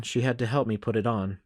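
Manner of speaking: ordinary delivery, nothing marked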